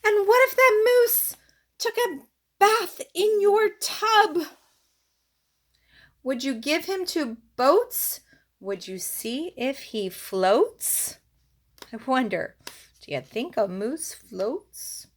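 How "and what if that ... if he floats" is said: lively, singsong picture-book read-aloud; reading to children. Acted. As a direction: read it to a little one, bouncing the rhymes.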